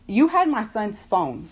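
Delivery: usual speaking voice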